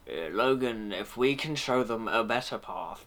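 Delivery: a hint of a theatrical Ian McKellen; Ian McKellen impression. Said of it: "I'm going more Ian McKellen then".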